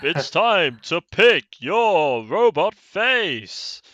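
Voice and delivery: slow, loud, high host voice; game show host. Pretend. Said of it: "It's like".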